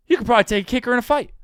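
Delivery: mock the people who doubt him; imitating doubters. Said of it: "everyone's probably thinking".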